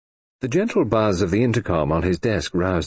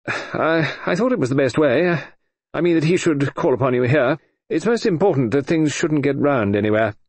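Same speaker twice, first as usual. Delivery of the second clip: higher, stammering official; fussy Sir George; 6 semitones higher than usual. A narrator's reading. Sir George explaining to the Colonel, nervous and fussy.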